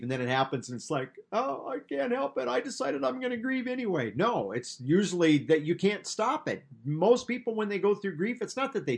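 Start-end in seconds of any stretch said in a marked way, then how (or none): 1.3-4.1 high, put-on helpless voice; mock helplessness. Preacher parodying someone who supposedly chooses grief, mock-helpless. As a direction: pipe up in pretend helplessness, much higher pitched. Mocks deciding to grieve helplessly.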